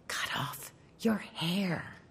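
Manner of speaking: slow, soft breathy whisper; tiny voice whispering a secret. A narrator's reading. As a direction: breathe it right into her ear. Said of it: "she heard a whisper right in her ear".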